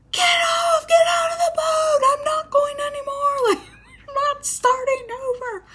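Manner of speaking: high shrieking call to the others to get off; yelling at them. Retold or imitated. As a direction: shout across the water in a panic. Yelling at the boat drifting past.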